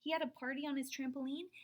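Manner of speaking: ordinary delivery, nothing marked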